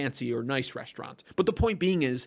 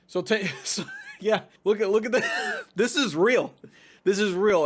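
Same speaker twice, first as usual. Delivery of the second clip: laughing disbelief; 7 semitones higher than usual. Real, not acted.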